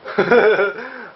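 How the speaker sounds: a loud burst of high laughter; sudden high laugh that falls away; real laugh. Real, not acted. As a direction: laugh out loud, caught by surprise. Laughs at own joke.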